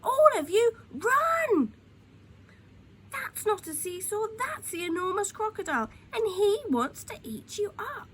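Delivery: high, loud alarmed warning; urgent warning shout. A narrator's reading. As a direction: shout it so every child runs. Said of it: "Mugglewump shouted to the children".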